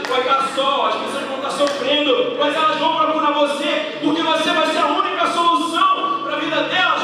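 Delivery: impassioned pulpit shouting; full-force preaching. Acted. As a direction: preach it out with rising fire to the congregation.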